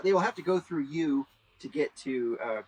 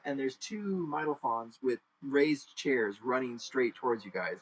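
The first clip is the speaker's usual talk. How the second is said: ordinary talk, nothing marked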